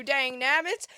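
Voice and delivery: loud folksy Southern sign-off; a hammed-up Southern exclamation; Southern accent. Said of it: "still talking with a Southern accent".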